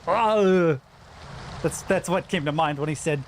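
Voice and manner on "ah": slow, lightly done Austrian action-hero shout; a hint of Austrian accent, barking the order slowly; Arnold Schwarzenegger accent. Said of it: "bad impression of an Austrian accent".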